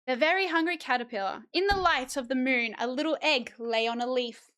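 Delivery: storybook read-aloud, rising as hunger builds; reading to children. Acted. Streamer reads The Very Hungry Caterpillar to chat, storybook voice. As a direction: read it like story time, lifting each still hungry.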